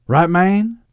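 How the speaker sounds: loud, high, rising plea for confirmation; vulnerable Southern henchman. Acted. Doctor Loeb looking to Edwin for confirmation, needy. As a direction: ask needily in a Southern voice. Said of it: "surprising vulnerability".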